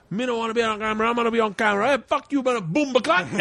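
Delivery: high-pitched caricature of arguing in patois; mock Jamaican argument. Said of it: "they're arguing in patois".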